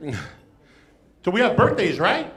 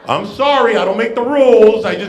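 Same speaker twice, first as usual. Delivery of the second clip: louder, firm bouncer voice; stern bouncer; 4 semitones higher, 9 dB louder than usual. Acted. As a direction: lay down the rules like a bouncer. Acting bouncer at family party.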